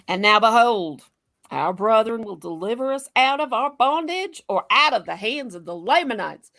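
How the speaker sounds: emphatic Southern reading, opening loud; Southern accent. Acted. Co-host reading scripture aloud to the panel, Southern accent. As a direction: read it like scripture in Southern dialect. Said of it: "I do this Southern thing".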